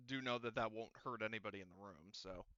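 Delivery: ordinary talk, nothing marked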